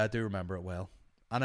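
ordinary talk, nothing marked

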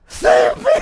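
loud, high breathy cry; hammed-up call for rescue; imitating the rescued rafter; mock desperate plea. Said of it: "he's like".

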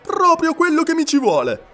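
high and loud, thrilled; delighted outburst. A narrator's reading. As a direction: shout it like the answer to a prayer. Passepartout's delighted cry.

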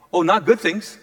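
ordinary talk

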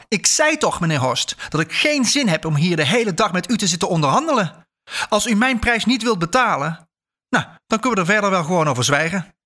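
high-pitched, exasperated scolding; angry outburst. A narrator's reading. Old woman refuses to negotiate her price, shouting angrily. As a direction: rail at him with pitch swooping high. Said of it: "schreeuwde de oude vrouw".

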